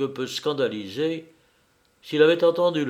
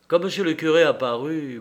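usual speaking voice

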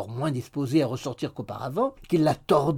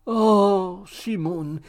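raised coy exclamation, falling away; coy cry; 5 semitones higher, 6 dB louder, much slower than usual. A narrator's reading. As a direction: sigh his name out dramatically. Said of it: "cria Miggs". (exclamation minaudière)

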